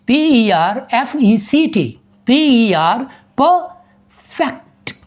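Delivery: letters spelled slowly, then perfect drawn out. Shown as a demonstration. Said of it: "perfect ऊपर लिख रहा हूँ". (शब्द को धीरे-धीरे अक्षर-अक्षर बोलना)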